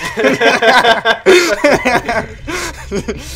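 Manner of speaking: loud, high burst of laughter; real loud laugh. Real, not acted. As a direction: crack up loudly and long. Cracking up at own joke.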